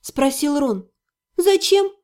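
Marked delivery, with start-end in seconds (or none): 1.4-1.9 high-pitched puzzled question; lightly surprised, higher voice. A narrator's reading. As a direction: ask it in mild surprise, pitched up. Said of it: "удивилась я".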